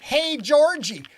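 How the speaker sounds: put-on high, loud Yooper accent shout. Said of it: "West Side in a Yooper accent".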